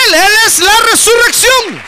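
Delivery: high, loud proclamation of the resurrection; fiery preacher's cry; preaching shout. Real, not acted. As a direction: belt it out to the congregation, ringing. Preacher proclaims at full force.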